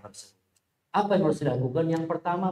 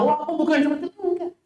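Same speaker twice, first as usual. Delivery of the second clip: defensive retort; 14 semitones higher, faster than usual. Retold or imitated. Voicing a defensive reply.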